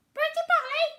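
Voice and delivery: very high, loud squawking parrot. A narrator's reading. Other parrots showing off, loud squawking parrot. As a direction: squawk it like a bird. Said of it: "Other parrots could say".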